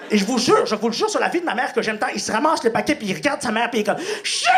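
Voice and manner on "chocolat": put-on shrill high kid scream for chocolate; child shrieking for candy. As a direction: scream it like a greedy toddler.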